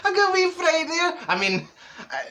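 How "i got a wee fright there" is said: high, laughing surprise; giddy shock at her own look; startled laugh. Real, not acted. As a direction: gasp and giggle at yourself.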